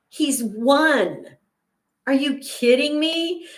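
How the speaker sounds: high-pitched incredulous protest; indignant disbelief. Real, not acted. Indignant at son's self-soothe idea.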